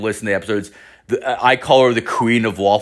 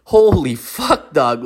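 slow, drawn-out high exclamation; re-enacted shock, pitched up and slow; retold astonishment; 4 semitones higher, slower than usual. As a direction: stretch it out in amazed disbelief. Reacting to friend's shocking story.

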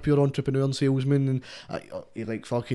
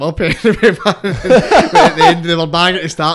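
laughing through it, higher and much louder; 5 semitones higher, 12 dB louder than usual. Real, not acted. Laughing while telling cocktail story.

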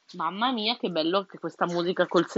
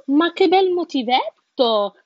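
higher, louder, slow delighted bird; 7 semitones higher, 6 dB louder than usual. A narrator's reading. Narrator voicing the little bird, delighted by the tune. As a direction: sing it out like a cheerful little bird. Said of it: "e disse".

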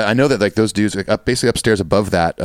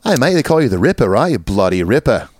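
mock Australian accent; 4 semitones higher than usual. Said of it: "G'day, mate".

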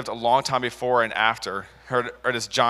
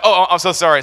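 high, louder flustered sorry; flustered apology; 6 semitones higher, 6 dB louder than usual. Retold or imitated. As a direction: backpedal fast, embarrassed, higher and louder. Boy backpedals, flustered.

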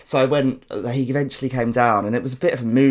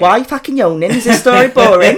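loud, high-pitched outraged challenge; playful telling-off, pitched right up; mock indignant; 11 semitones higher, 10 dB louder than usual. Real, not acted. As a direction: call out the yawning listener, loudly affronted. Mock telling-off of yawning listener.

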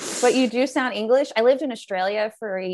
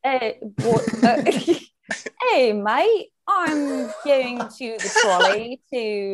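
halting attempt at British, laughing; British accent; much slower than usual. Shown as a demonstration. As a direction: feel your way through a British accent. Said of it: "Do a British one".

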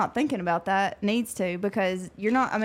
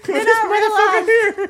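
laughing hard while talking; 10 semitones higher, 7 dB louder, much slower than usual. Real, not acted. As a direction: crack up mid sentence.

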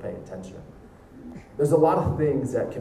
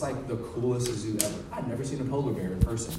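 normal talking voice

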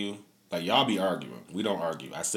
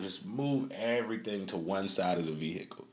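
usual speaking voice

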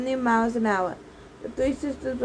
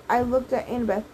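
plain reading voice

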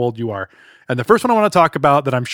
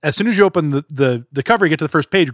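normal talking voice

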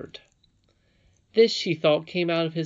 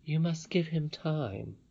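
gentle grandmother, consoling; 3 semitones higher, 5 dB quieter, slower than usual. A narrator's reading. Grandmother consoles the sobbing princess, slow and gentle.

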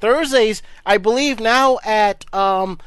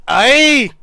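mock Irish cheer; 7 dB louder than usual.

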